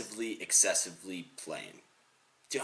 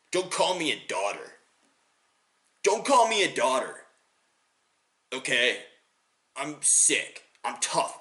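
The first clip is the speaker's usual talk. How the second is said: higher-pitched macho objection, building; offended male ego; 4 semitones higher than usual. Acted. Tough guy rejecting the nickname daughter, offended. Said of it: "appeal well with the male ego".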